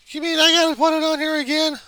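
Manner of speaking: high, loud complaint; exasperated whine. Acted. Exasperated at redoing his work.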